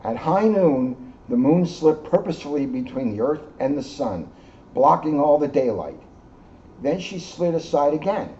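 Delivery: gentle read-aloud storytelling; story narration. A narrator's reading. Reading the moon story to the children, storyteller voice. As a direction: tell it like a bedtime story to kids. Reads picture book to children.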